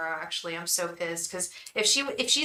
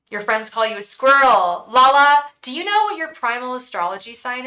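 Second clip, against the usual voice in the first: calling out; 5 semitones higher, 10 dB louder than usual. Real, not acted. Calling out to viewer Lala.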